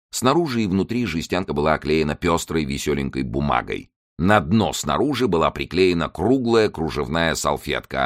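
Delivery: ordinary delivery, nothing marked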